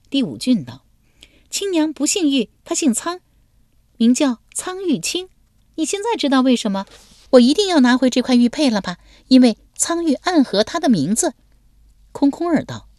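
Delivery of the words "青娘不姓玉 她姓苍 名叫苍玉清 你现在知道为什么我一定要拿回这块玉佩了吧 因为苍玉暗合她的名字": put-on high, lively girlish voice; pitched-up playful young woman; young woman's voice. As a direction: play the bold young lady teasing him.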